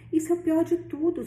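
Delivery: um toque de suspiro, mais agudo; suspiro leve. A narrator's reading. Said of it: "suspirou a mulher". (tia suspira ao sobrinho, leve suspiro, voz mais aguda)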